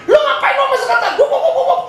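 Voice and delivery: very high, loud mock scolding; raging wife. Wife scolds husband over the secretary, high mock rage. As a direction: imitate a wife going off, gabbling. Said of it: "ngamuk istrinya, dia dimarahin".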